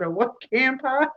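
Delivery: loud, high incredulous echo; retold bafflement. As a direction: act out a scandalised double-check. Retelling her baffled reaction.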